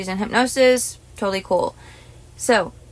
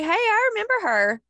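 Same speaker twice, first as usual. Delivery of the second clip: high, airy voice for the cat; lightly voiced cat recognizing her; 12 semitones higher than usual. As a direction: speak as the friendly cat. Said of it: "He's like".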